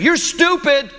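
put-on high whiny child yelling at mom; mock bratty kid yell. Preacher imitating a bratty child yelling at his mom, high and whiny. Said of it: "he kept saying".

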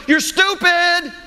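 very high, slow mock kid taunt, stretched out; imitating a child yelling. Boy shouting at his mom over the shelving, very high mock child taunt. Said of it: "yelling over, over the shelving".

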